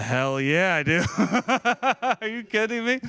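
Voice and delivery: loud yes rising into high, laughing disbelief; thrilled acceptance. Retold or imitated. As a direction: burst out with an overjoyed yes. Acting out his delighted acceptance of offer.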